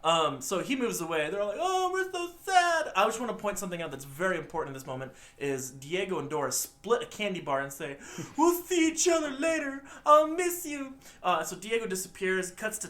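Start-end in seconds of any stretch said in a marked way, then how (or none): 1.6-2.9 high, drawn-out mock lament; mock sadness. Retelling the cousins' parting, mock sad. Retelling children's goodbye in the movie. 8.4-10.9 put-on high-pitched little-kid farewell; mock child goodbye. Dora and Diego saying goodbye, mock child voice.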